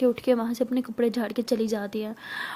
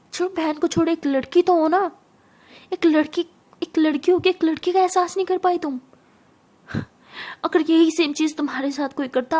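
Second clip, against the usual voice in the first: loud, higher-pitched scolding; गुस्से भरी डांट; 4 semitones higher, 4 dB louder than usual. Acted. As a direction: tear into them with angry, forceful questions. Acted furious scolding over abandoning sister. (गुस्से में ज़ोर से फटकार)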